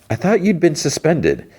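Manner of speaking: high-pitched imitated challenge; retold disbelief. Lady worker confronting the suspended conductor, imitated high-pitched disbelief.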